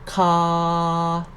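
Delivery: "Ka" drawn out on a level pitch; mid tone. Shown as a demonstration. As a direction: hold "Ka" long and even. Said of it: "no tone mark represent the mid tone".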